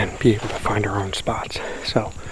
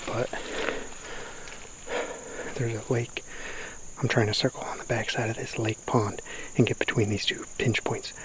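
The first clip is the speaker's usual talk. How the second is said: hushed; 11 dB quieter than usual. Real, not acted. Stalking quietly through woods.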